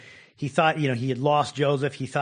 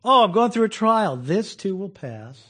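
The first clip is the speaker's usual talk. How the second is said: calm acceptance; 4 semitones higher, 6 dB louder than usual. Retold or imitated. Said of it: "I can do that".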